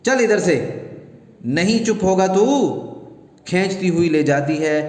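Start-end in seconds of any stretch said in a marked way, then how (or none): 0.0-2.4 angry command. Acted. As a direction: order the boy out angrily. Mother drags child away.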